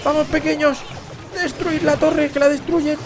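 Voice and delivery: high, eager cheering on the troops; excited cheering. Real, not acted. Caster cheering the hog riders on, excited high calls. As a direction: urge them on like a fan in the stands.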